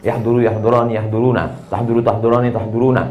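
low, monotone recitation of verb forms; rote conjugation drill. Shown as a demonstration. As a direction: recite it evenly from memory.

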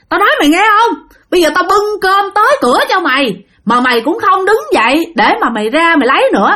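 high-pitched exasperated scolding; angry yelling. A narrator's reading. Sáu fed up with Bảy not answering, high angry scolding. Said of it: "Ông Sáu mới la làng lên nói".

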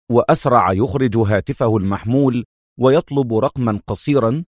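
ordinary delivery, nothing marked

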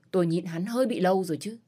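ordinary delivery, nothing marked

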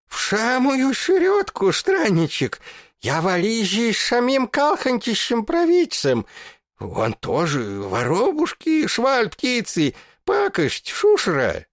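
put-on high lisping old man, sputtering; shrill toothless geezer spitting as he yells; toothless mumble. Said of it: "Зашамкал, брызжа слюной". (шамкающий беззубый старик)

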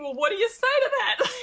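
high, laughing through the words; laughing disbelief. Retold or imitated. Advocate retelling the man's flat denial, laughing.

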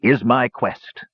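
slow, weighty declaration; proud announcement. A narrator's reading. Dwarf captain revealing his quest, slow and proud. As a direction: draw it out for grand effect.

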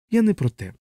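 high, embarrassed protest; flustered chaplain's high voice; flustered, blushing. A narrator's reading. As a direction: pitch up suddenly, as if caught out. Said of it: "враз зашарівшись".

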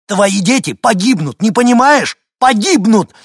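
very high, very loud shout; desperate yelling. Acted. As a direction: scream it to get through to someone. Мужчина кричит, пытаясь спасти детей. (отчаянный, яростный крик)

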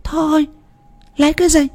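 put-on high old woman snapping him down; sharp old wife. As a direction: brush him off, high and scornful. Bà vợ chê con chó. (giọng bà già cao, gạt phăng)

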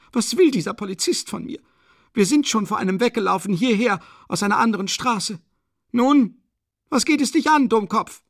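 high, loud, railing woman's voice; indignant widow. A narrator's reading. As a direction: tell the policeman off in a high, agitated voice. Katharina rails at the policeman. (empörte Witwe, hoch und laut schimpfend)

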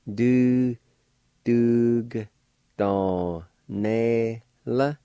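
Du, dug, don, ne, la, each syllable separate, Du said without the G; syllables said one by one. Shown as a demonstration. Teacher sounding out Du, dug, don, ne, la for learners. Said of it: "like this".

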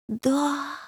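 soft sighing yes; sad sigh. A narrator's reading. Little devil sighs sadly at the news. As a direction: let it fall out sadly. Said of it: "грустно вздохнул Лемах".

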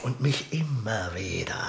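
slow, soft, resigned; bitter murmur. Acted. King admitting she defies him too. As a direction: let it sink in wearily.

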